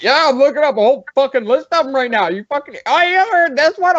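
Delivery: squeaky overexcited buddy; imitating excited friend. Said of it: "You know, like".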